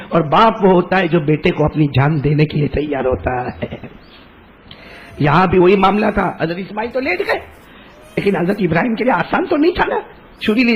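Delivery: usual speaking voice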